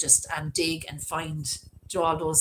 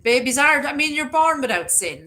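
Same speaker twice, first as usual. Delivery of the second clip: higher, rising passionate insistence; impassioned protest; 8 semitones higher, 6 dB louder than usual. Real, not acted. Defending unbaptized babies to viewers, with impassioned, higher insistence. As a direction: plead the point with feeling. Indignant about unbaptized infants.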